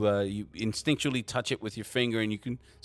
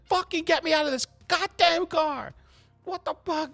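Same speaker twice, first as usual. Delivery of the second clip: much higher, loud panicked woman's voice; Abby freaking out; 4 dB louder than usual. Acted. GM voicing Abby yelling to get out of the car. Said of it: "you can hear Abby's like".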